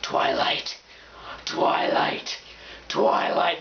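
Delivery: whispered obsessive chant; creepy mock whisper. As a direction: whisper it like a crazed fan. Imitates girls in mental hospital.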